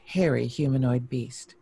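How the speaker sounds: usual speaking voice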